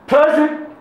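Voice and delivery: high, loud shout of attendance; sudden high-pitched yell; shouted roll call. Shown as a demonstration. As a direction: shout it out like answering the register. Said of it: "put your hand up and shout".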